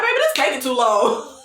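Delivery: mock impatience dissolving into laughter; complain, then crack up; laughing complaint. Real, not acted. Vlogger griping about the endless laces, laughing.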